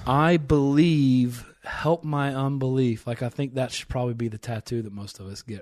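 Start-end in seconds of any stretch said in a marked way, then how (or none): 0.1-3.0 loud, drawn-out "I believe", easing off after; emphatic repeated cry. Retold or imitated. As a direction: echo the father's plea slowly and forcefully.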